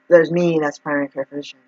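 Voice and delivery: ordinary talk, nothing marked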